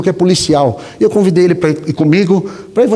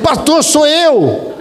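high, slow knowing voice; slow reveal; 9 semitones higher, much slower than usual. Retold or imitated. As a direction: draw it out like a grin spreading. Old acquaintance reveals himself.

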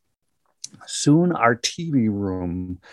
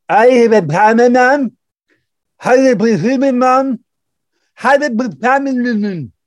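garbled, much higher and louder slurred words; slurred stroke speech; 12 semitones higher, 6 dB louder than usual. Retold or imitated. Stroke patient pleading with the paramedic, garbled slurred speech. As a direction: mash the words as if the mouth won't obey. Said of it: "this is what it sounded like".

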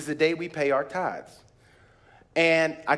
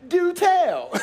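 high, loud mock delight; playfully pleased do tell; amused do tell; 11 semitones higher, 3 dB louder than usual. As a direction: savour the good news, teasing. Reacting to refund news.

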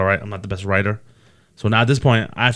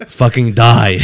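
laughing through pain; 6 dB louder, much slower than usual. Real, not acted. Laughing hard about laughter torture.

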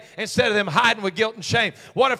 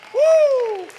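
high joyful woo; high whoop; 8 dB louder than usual. Real, not acted. Preacher whoops to rouse his church.

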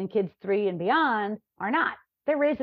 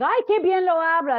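impressed exclamation; 9 semitones higher, 6 dB louder than usual. Retold or imitated. Cashier praising her Spanish.

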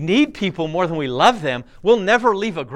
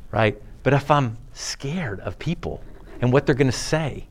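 ordinary delivery

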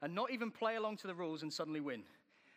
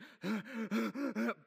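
loud heaving gasp; exhausted gasp; 5 semitones higher, 4 dB louder than usual. Retold or imitated. Preacher acting his breathless reply to his wife, heaving gasp. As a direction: suck in air, spent.

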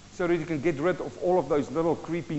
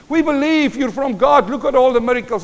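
Nicodemus praising; 6 semitones higher, 8 dB louder than usual. Retold or imitated. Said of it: "He says".

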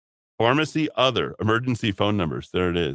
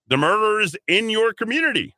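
much higher, louder outrage; outraged exclamation; 12 semitones higher, 4 dB louder than usual. Real, not acted. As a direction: throw it at them, incensed. Host's outraged punchline.

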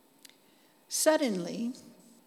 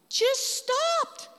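amazed. Real, not acted. Feather stops in midair.

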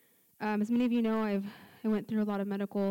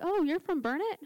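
higher-pitched imitation of a surprised stranger; retold pleasant surprise; 9 semitones higher, 4 dB louder than usual. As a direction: imitate someone discovering a hometown connection. Imitating a surprised stranger's small talk.

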